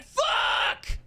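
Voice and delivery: hoarse shouted curse, no voice; over-the-top yell. Acted. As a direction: blow your voice out on it. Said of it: "That's me trying too hard".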